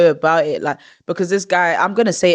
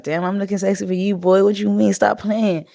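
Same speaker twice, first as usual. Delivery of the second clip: higher, quick teasing comeback; playful flirty reply; 5 semitones higher than usual. Acted. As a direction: play along with the compliment, voice lifted. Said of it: "if it was in a relationship and cool".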